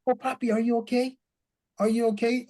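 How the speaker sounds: a hint of a worried little boy asking after papa; little son asking. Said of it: "he said".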